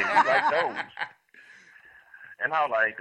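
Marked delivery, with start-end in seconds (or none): 0.0-0.8 high, laughing retold quote. Caller quoting them while laughing, high and giggly. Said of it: "They say".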